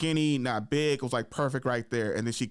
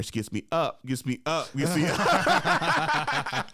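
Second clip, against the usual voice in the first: laughing; 5 semitones higher, much slower than usual. Retold or imitated. Repeated chant, last one laughed out.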